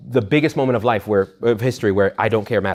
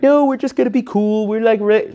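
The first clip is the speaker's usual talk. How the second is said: much higher, breezy mock dude; higher mocking impression of someone who thinks God is a buddy; mock casual voice; 10 semitones higher, 4 dB louder than usual. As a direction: parody a too-relaxed believer.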